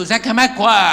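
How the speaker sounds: high, loud taunting hello; mocking greeting. Friends taunt a man with a nickname, high and loud. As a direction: jeer the nickname at him. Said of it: "يقول له".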